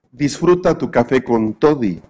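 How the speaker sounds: ordinary talk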